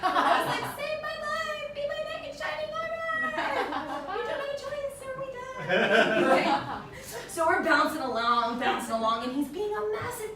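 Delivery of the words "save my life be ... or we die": mock damsel plea. Mock pleading to boyfriend.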